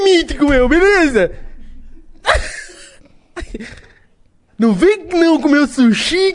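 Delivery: high, loud, laughing hype host; hyper podcast host voice. Pretend. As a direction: shout it like a manic host.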